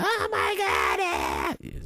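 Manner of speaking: very high, very slow shrieked "Oh my God"; Joe Rogan impression. Mocking the commentator's excited call, very high drawn-out shout. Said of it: "Joe Rogan screaming with his high-pitched, voice that's so damn annoying".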